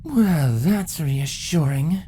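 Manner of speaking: low, slow dry sarcasm; sarcastic. A narrator's reading. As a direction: drag it out, deadpan and mocking. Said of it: "commented sarcastically".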